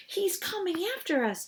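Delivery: high, alarmed cry; panicked warning to friends; cried out. Acted. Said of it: "Dink cried".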